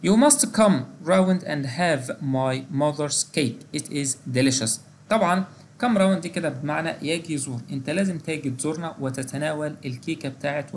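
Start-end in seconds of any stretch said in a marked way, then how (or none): 0.1-4.7 teacher reading a model sentence slowly; slow example read. Shown as a demonstration. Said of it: "مثال".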